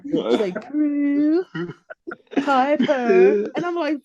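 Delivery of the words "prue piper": imitating Patty calling. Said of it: "neither one of them say the daughter's name".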